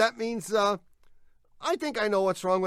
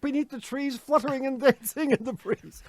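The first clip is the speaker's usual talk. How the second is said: louder, higher grand recital; poem recital; 6 semitones higher, 8 dB louder than usual. Acted. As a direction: declaim it grandly, getting louder.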